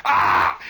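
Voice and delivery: loud breathy mock shriek; corn ear scream. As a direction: rip out a voiceless corn scream. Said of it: "they yell".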